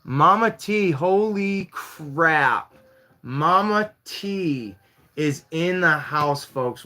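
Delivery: loud, high, drawn-out shout of surprise; amazed announcement. Real, not acted. Host greeting an unexpected guest in the live, amazed and hyped. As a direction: announce a surprise guest with slow, stretched words.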